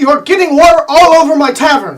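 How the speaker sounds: loud, high scolding shout; angry innkeeper. Acted. Innkeeper shouting at guests flooding his tavern. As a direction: yell at them for soaking your tavern.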